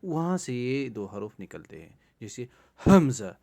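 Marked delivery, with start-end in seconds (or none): 2.9-3.3 حمزہ spoken loud and crisp from the back of the throat; throat letter demo. Shown as a demonstration. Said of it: "آپ بھی try کریں بار بار". (حمزہ کو حلق کے آخری حصے سے واضح ادا کریں)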